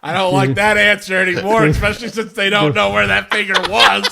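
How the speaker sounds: loud and high through laughter; laughing. Real, not acted. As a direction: shout it while cracking up. Laughing disgust.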